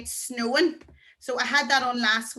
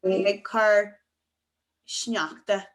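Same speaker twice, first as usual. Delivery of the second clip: very slow, with a pause before sneachta; drawn-out teacher's model; slow demonstration; 3 dB louder, much slower than usual. Shown as a demonstration. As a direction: spell it out word by word for learners. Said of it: "that's how you say it's snowing".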